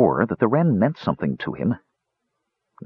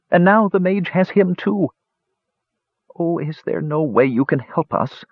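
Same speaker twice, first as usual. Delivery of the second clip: higher, pleading little wren; pleading bird woman; 7 semitones higher than usual. Acted. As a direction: beg for help like a tiny bird. Wren tells her tale.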